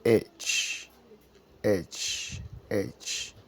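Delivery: "H" said as English "aitch", breathy. Shown as a demonstration. Teacher drills English H for French learners. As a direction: repeat the English "H" slowly for learners. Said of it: "Et en anglais".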